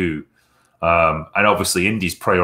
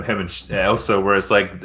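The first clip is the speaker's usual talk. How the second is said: normal talking voice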